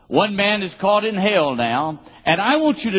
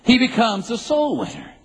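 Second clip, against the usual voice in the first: "He becomes a soul winner" pitched higher to land the pun; repeated punchline; 7 semitones higher than usual. Shown as a demonstration. Preacher repeating the pun the crowd missed, higher. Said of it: "That was a play on words".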